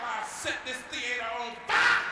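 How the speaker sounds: loud, slow hammed-up threat; drawn-out roaring mock rage; mock furious shout. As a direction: bellow it like you'd really torch the place. Mock threat over donuts.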